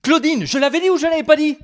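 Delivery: put-on loud, high smug old man; know-it-all fisherman. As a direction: crow about being right to Claudine. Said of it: "Gérard devant sa télé qui a fait".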